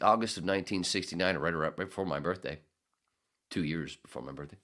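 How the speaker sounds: ordinary delivery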